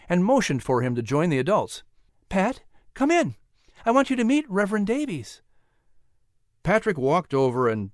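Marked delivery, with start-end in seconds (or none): 2.3-5.4 lightly voiced mother, higher and gentle; a proud mother calling her son in; Sarah. A narrator's reading.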